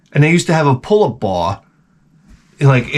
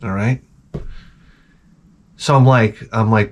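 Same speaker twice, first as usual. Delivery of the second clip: ordinary talk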